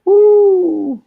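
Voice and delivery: loud high whoop; excited cheer. Real, not acted. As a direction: whoop it out, impressed. Cheering at photo count.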